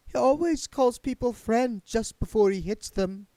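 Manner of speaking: a lightly voiced daughter sighing to her mother; weary daughter. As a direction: daughter warning her mother about the ghost.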